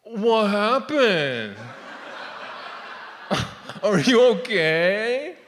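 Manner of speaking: slow, drawn-out mock concern; mean doctor. Doctor right up in patient's face, drawn-out mock concern. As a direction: feign concern, stretching each word. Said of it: "puts his face close to mine".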